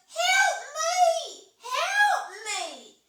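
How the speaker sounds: high, loud drawn-out cry; wailed plea for rescue, hammed-up; screaming for help. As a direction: scream it like someone in distress. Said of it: "somebody scream for help".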